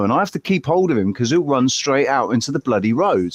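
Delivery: ordinary talk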